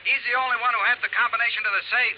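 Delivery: jabbing accusation at the investigator; yelling. Acted. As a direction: insurance boss raising his voice at Johnny. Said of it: "Don't start yelling at me".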